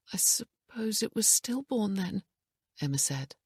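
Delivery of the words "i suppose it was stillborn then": high, hushed, on the edge of tears; tearful whisper. A narrator's reading. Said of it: "her eyes filling".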